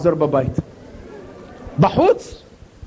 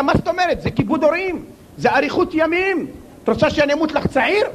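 higher, indignant quick protest; defensive husband; 6 semitones higher than usual. Retold or imitated. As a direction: protest your innocence, fast. Husband defends himself to wife.

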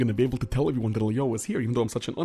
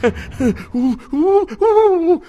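high shriek; 11 semitones higher, 8 dB louder than usual. Acted. Shock of icy water.

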